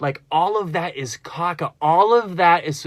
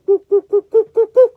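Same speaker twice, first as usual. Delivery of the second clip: very high, louder falsetto woos climbing up; rising woo; 12 dB louder, faster than usual. Shown as a demonstration. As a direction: soar up like creativity taking off. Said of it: "even higher and higher and higher".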